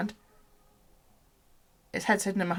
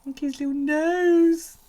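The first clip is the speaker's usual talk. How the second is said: high, louder and slow cooing; 10 semitones higher, 7 dB louder than usual. Real, not acted. Owner gushing over her snake in high cooing voice. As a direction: gush over the little nose.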